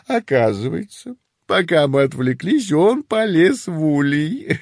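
amused mother dragon, giggling through; laughing storyteller mother. Acted. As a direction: tell it like a proud giggling mom. Mother dragon tells funny story. (весёлая рассказчица-мать)

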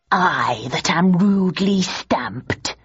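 slow, lightly voiced stage villain; muttering Richard. A narrator's reading. Richard cueing the audience's stamp, muttering slowly. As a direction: draw it out in a slight theatrical mutter. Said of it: "muttered Richard".

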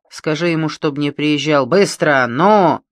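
резкий громкий приказ бандита; надавить, подгоняя; злой приказ. Acted. (злобно торопит)

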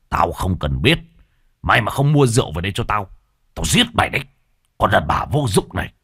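fast, angry threats; teeth-grinding fury. Acted. Enraged husband threatens wife over rum money, teeth-grinding fury. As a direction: curse through gritted teeth. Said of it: "nghiến răng kèn kẹt".

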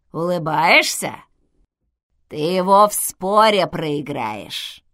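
put-on loud, slow old-woman intercom voice; old woman's voice. A narrator's reading. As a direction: drag it out like a creaky old woman. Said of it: "сказал голос старушки". (голосом старушки, громко и медленно)

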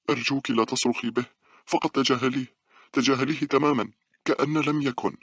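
high, frightened plea; fearful pleading. A narrator's reading. Scared girl begs her friend to stop screaming, fearful. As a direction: beg anxiously, pitch held up high. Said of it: "تشعر بالخوف وهي تقول".

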